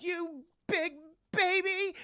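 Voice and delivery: put-on high, slow, taunting kid voice; teasing kid. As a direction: tease him as a big baby.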